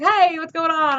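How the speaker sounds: high, loud imitated client hail; imitating a client's loud hey; called-out greeting. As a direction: call out a cheery hello, mimicking. Voicing a client calling out greeting.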